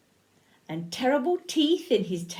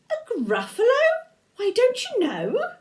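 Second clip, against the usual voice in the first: much higher and louder, wide-eyed disbelief; amazed mouse; 13 semitones higher, 6 dB louder than usual. Acted. Mouse astonished that the fox hasn't heard, high. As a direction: squeak it out as if everyone should know.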